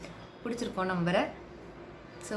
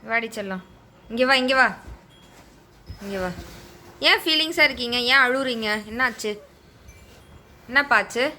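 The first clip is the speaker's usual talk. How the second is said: patient comforting; 3 semitones higher than usual. Real, not acted. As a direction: gently coax the little one to come close. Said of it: "பொறுமையா சொல்லியிருக்கேன்".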